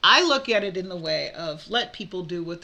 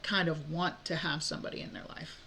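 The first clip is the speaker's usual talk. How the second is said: whispering; 3 semitones lower, 8 dB quieter than usual. Real, not acted. Aside about wanting a partner.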